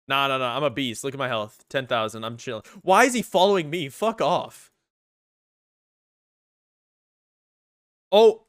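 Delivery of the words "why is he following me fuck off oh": panicking gamer. Real, not acted. Chased in game.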